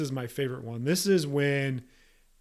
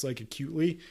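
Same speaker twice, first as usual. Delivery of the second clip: ordinary talk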